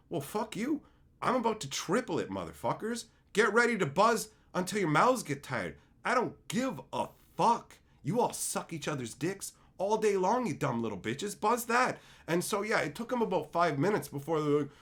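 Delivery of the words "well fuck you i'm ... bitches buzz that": raised, indignant comeback; worked-up retold tirade; defiant rant. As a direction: fire back in escalating defiance. Acted angry retort to friends.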